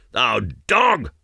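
explosive high bark on the last word; angry insult. A narrator's reading. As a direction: spit out the insult while seizing someone. Warrior seizes the frightened man.

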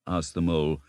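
ordinary delivery, nothing marked

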